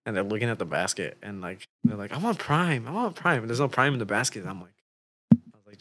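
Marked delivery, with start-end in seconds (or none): 2.1-3.4 mock pleading, pitched up; begging kids. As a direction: imitate kids pestering for a treat. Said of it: "They're just begging Jesse for Prime".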